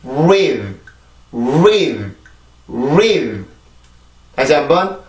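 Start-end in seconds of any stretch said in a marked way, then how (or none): 0.0-3.4 "breathe" without its B, starting on a rounded R: "reathe"; lower-pitched, drawn-out "breathe" begun straight on R; B left out. Shown as a demonstration. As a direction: drop the B from "breathe". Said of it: "비발음 빼고 발음을 해볼게요".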